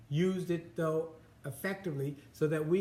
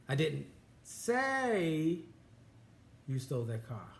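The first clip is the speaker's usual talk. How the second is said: "say" stretched long and raised, then a pause; stress on SAY; slower than usual. Shown as a demonstration. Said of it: "Emphasis on different words using the same sentence".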